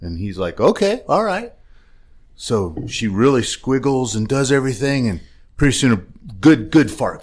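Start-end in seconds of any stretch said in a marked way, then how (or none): 0.6-1.5 mock high okay. Joke, husband reacts to field goal.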